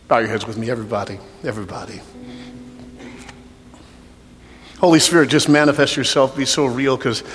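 soft, low prayer voice over music; altar call prayer. Real, not acted. Pastor leading an altar call prayer, soft and low. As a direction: pray gently over the bowed room.

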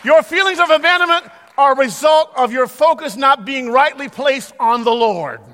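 loud, high, ringing sermon delivery; full-force preaching. Acted. Pastor exhorting the church in a loud preaching voice. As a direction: preach it to the congregation at full power.

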